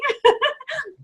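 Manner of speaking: a sudden high laugh that falls away; high bright laugh. Real, not acted. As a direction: laugh at your own joke. Laughing at own joke.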